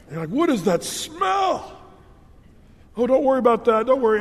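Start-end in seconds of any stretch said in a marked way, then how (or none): 0.3-1.8 high, slow, shouted disgust at the smell; disgusted shock. Acted. Acted disgusted quote in story. 3.0-4.2 hasty reassurance. Acted. Acted quote, hiding the smell.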